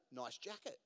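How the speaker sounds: low, hushed little voice; mysterious voice. Acted. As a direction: drop softly into an unseen voice's compliment. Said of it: "he hears a voice say".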